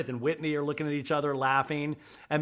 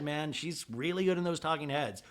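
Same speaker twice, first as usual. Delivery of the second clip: ordinary delivery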